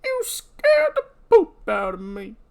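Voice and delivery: put-on high, slow, quavering old-timer; old prospector. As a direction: whine it like a rattled old codger. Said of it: "It just really works for this voice".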